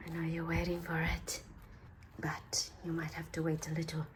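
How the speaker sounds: soft, low teasing whisper. Pretend. Crafter teasing viewers waiting for the reveal, whispered. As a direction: whisper to keep them waiting.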